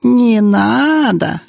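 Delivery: very high, long-drawn mock whine; deliberately squeaky, stretched-out jeer; squeaky mocking mimicry. A narrator's reading. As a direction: mimic a coward in exaggerated squeak. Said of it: "нарочито песклявым голосом передразнила его".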